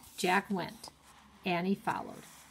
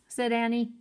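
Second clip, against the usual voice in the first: ordinary delivery, nothing marked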